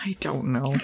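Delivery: soft unvoiced whisper. Real, not acted. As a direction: breathe it under your breath. Whispered aside.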